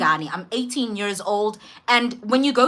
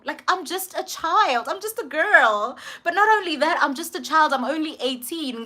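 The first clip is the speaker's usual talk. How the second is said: protesting innocence; 5 semitones higher than usual. Retold or imitated. Teenager protests to doctor that she's a child, high playful pleading. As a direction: plead girlishly, pitched up.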